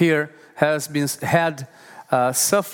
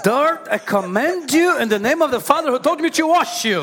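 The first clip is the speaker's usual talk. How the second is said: higher, laughing mock command; mock command to dirt; 9 semitones higher than usual. Shown as a demonstration. Preacher jokingly commanding dirt on a dish, laughing. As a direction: playfully order the dirt off the dish. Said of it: "I'm commanding the dirt".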